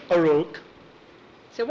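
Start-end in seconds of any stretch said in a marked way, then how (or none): 0.1-0.6 frog croak. Acted. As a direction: croak like a bullfrog. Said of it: "Croaky went".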